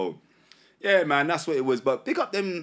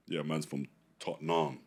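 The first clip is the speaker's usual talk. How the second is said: low, soft put-on accent, Tottenham stretched to Totten-nahm; mock the affected way of saying Tottenham, low and quiet; 10 semitones lower, 10 dB quieter than usual. Rapper mocking how others rep their area, put-on accent. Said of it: "I'm not trying to talk like".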